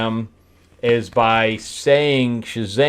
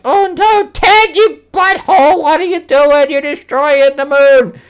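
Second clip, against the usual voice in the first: very high, loud cartoon alarm; mock panicked yell; 8 dB louder than usual. Yelling at Ted for destroying the moon, mock panicked yell. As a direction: shriek the scolding, hammed-up. Acting out alarm at exploding drive.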